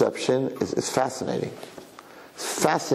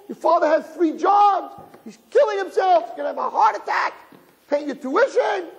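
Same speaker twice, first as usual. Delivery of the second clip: hysterical mother. Retold or imitated. Mother screaming at her son over a fifty, hammed-up and shrill-high. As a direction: play the frantic mother ranting over the grade.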